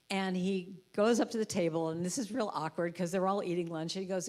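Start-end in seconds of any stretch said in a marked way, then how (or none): none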